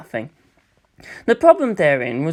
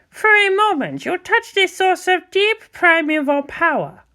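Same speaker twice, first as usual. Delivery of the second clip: high, slow, solemn questioning voice; council elder; 14 semitones higher than usual. Acted. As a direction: probe gently and gravely.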